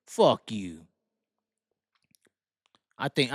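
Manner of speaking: ordinary delivery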